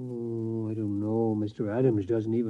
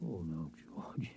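slow, softer hushed refusal; whisper; 8 semitones lower, 16 dB quieter than usual. Acted. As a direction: say it under your breath, almost a whisper.

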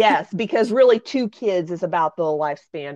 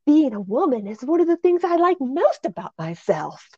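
high prim fifties announcer lady; 8 semitones higher than usual. Retold or imitated. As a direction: chirp it like an old educational film. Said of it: "some lady saying".

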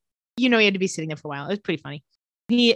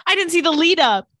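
high, loud, put-on whine; a high, loud, whining protest; whiny Rose complaint; 10 semitones higher, 6 dB louder than usual. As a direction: squeal it like a thwarted teen. Retelling Rose's annoyed complaint.

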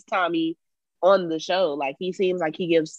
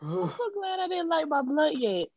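laughing tears; 9 semitones higher, 7 dB quieter than usual. Real, not acted. Crying with laughter.

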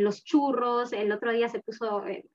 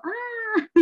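surprised ah; 13 semitones higher than usual. Retold or imitated. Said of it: "yo digo".

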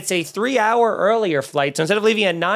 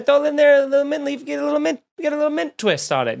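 playful put-on voice; 10 semitones higher, faster than usual. Playful put-on voice over mint.

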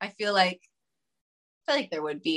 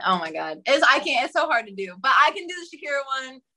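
usual speaking voice

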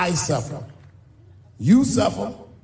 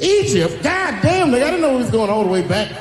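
much higher, louder outraged disbelief; a much higher, louder mock-outraged yelp; 6 semitones higher, 3 dB louder than usual. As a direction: cry out, floored by the comeback. Outdone in the suffering game.

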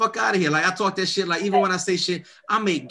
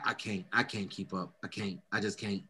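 much softer, lower, giving up; defeated; 6 semitones lower, 11 dB quieter than usual. Real, not acted. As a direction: let it fade out in defeat. Overwhelmed by friend's posts.

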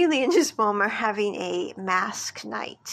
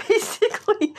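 laughing; 11 semitones higher, 5 dB louder than usual. Real, not acted. Laughing after voicing the mom.

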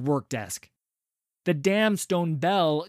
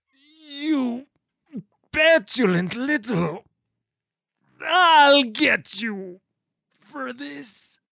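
loud, slow, higher sputtering threat; sputtering foiled villain; 10 semitones higher, 7 dB louder, much slower than usual. Acted. Poisoned villain threatens, doubled over.

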